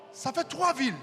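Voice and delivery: usual speaking voice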